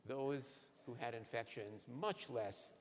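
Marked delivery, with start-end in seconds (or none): none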